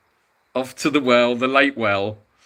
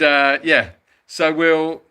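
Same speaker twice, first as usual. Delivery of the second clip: ordinary talk, nothing marked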